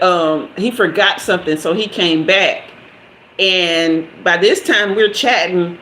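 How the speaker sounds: ordinary talk, nothing marked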